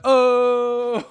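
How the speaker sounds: long, high, loud "oh"; drawn-out imitation of a movie cry; mock "oh". Retelling the slap for laughs, loud high mock cry. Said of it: "he slapped her and he's like".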